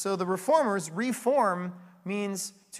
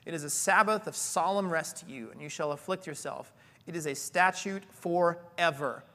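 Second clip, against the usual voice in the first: scripture reading; 3 semitones lower than usual. Acted. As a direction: read the passage gravely. Reads scripture aloud.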